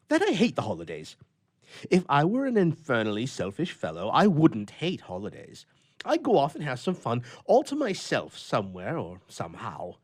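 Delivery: a lonely grouch airing his gripes; grumpy old bachelor. A narrator's reading. Bluff continues his complaint.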